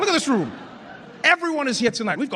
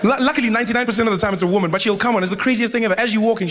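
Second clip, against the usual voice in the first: ordinary delivery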